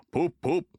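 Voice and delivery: loud poop poop; big engine whistling to the passengers; engine whistle. A narrator's reading. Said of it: "he whistled".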